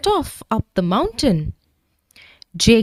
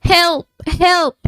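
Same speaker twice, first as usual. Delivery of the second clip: high, carrying calls for help; calling for help; 10 semitones higher than usual. A narrator's reading. Jake stuck on the ledge, calling out for help. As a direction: call out to anyone who might hear. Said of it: "he called".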